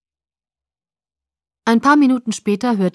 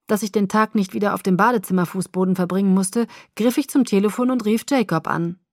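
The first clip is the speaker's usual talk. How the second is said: plain reading voice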